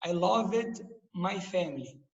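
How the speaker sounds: slow, careful "loved" with a soft final d; "loved" ending softly, no extra vowel; correct English loved. Shown as a demonstration. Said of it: "Muito importante isso de pronúncia".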